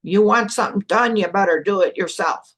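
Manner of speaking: lightly voiced mom, starting loud; mom's way of talking. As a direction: quote your mom with a firmer push. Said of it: "That's the way she talked".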